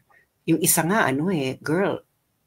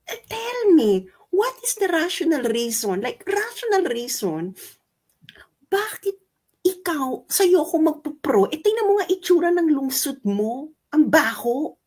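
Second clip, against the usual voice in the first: high-pitched, incredulous demand; exasperated challenge; 11 semitones higher than usual. Real, not acted. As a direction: press them for a reason, pitched high. Challenges critics to justify themselves. (naiinis na panghahamon, mataas ang boses)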